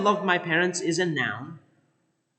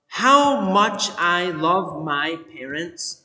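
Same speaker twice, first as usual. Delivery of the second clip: slow, deliberate, louder phrase; slow example; 5 dB louder, slower than usual. Shown as a demonstration. Teacher presenting the new phrase, slow and deliberate. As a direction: lay out "how much I love my parents" slowly. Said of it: "what if we said".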